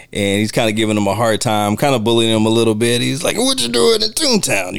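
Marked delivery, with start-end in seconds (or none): 3.4-4.7 high mocking taunt; bully's voice. Bar bully taunting the detective, high mocking voice. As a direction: sneer it up high like a toon bully. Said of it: "he has, like, a distinct voice".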